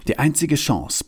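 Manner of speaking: usual speaking voice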